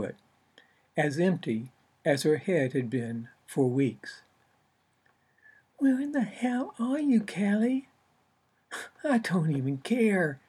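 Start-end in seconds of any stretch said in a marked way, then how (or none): 5.8-10.4 exasperated author. Acted. Author calling for absent muse.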